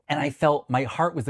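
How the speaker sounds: normal talking voice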